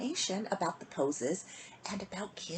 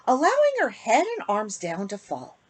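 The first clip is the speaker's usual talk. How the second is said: put-on lilting rhymed read-aloud for kids; storybook reading; 9 dB louder than usual. A narrator's reading. Host reading a bedtime rhyme to kids, lilting. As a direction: read the rhyme to children, sing-song. Reads picture book to children.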